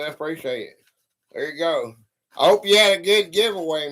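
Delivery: ordinary delivery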